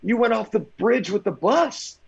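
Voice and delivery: high, delighted I remember you; light up at meeting a familiar stuntman; excited recognition. Retold or imitated. Stunt veteran greeting James in a bagel shop, high and delighted. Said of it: "And he goes".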